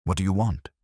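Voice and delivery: a touch of Spanish melody, wary and guarded; lightly accented Cuban secretary, careful; melodic cautious accent. Acted. Secretary answering the detective warily, light Spanish accent. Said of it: "Her accent had melody and caution".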